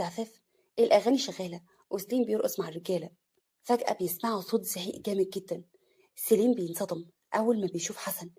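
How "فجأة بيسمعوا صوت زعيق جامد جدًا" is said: usual speaking voice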